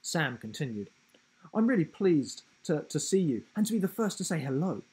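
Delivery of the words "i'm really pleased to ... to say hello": fast, eager, tumbling words; excited giraffe. A narrator's reading. Giraffe greeting Will at last, fast and eager. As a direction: rush it out, thrilled to meet him.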